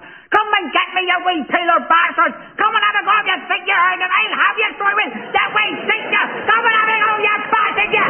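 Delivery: high, yelling Belfast women goading the police; shrieking Belfast taunts. Retold or imitated. Belfast women goading the riot police, in a high shrieking yell. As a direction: screech it at them like a defiant Belfast woman. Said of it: "all the women piped up".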